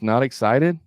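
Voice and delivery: "excited" lifted at the end as a question; just "Not excited" with an upward tail; rising inflection. Shown as a demonstration. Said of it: "put an inflection on the end".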